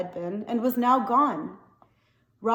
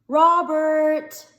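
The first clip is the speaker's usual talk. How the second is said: loud, higher call from far off; distant call; 6 semitones higher, 7 dB louder than usual. Acted. Dad calling his son from the motel, far-off shout. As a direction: shout the name from across the field. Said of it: "came floating to them from the direction".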